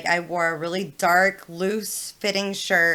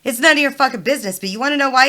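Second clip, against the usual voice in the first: raised, loud imitation of an angry father; retold furious dad; 6 semitones higher, 7 dB louder, faster than usual. Dad confronting assistant principal over daughter's bra, furious. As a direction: lay into him as the indignant dad. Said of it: "my dad comes in furious".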